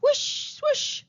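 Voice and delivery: very high airy swooshes; magic wand whoosh. Acted. Queen waving her magic wand, voiced whoosh. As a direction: swish the wand with your voice, high.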